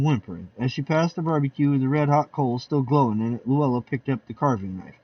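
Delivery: ordinary delivery, nothing marked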